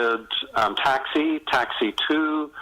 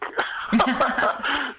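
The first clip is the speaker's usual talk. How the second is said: laugh; 13 semitones higher than usual. Real, not acted. Own laugh.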